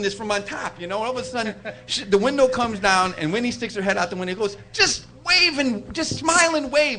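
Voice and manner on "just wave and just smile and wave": higher, drawn-out imitated voice; slow, high-pitched mock instruction. [name] at window, voiced in story.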